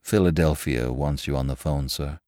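lightly voiced butler, low and soft; a hint of a discreet butler, dropping away on "sir". A narrator's reading. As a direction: pass on a message quietly, like a butler. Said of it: "Then the butler, behind his shoulder".

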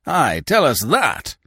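slow, high, loud demand; roaring. A narrator's reading. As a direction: roar out the challenge. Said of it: "roared Monsieur Binet".

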